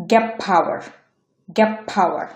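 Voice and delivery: gap power, with "get" ending in p; assimilated T. Shown as a demonstration. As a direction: say "gep" straight into power for gap power. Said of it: "এটা উচ্চারণ হবে".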